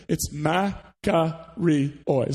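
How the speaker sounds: makarioi said very slowly, syllable by syllable: ma-ka-ri-oi; sounded out slowly. Shown as a demonstration. Pastor teaching a Greek word to the church, sounded out slowly. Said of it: "I'm gonna sound it out for you first".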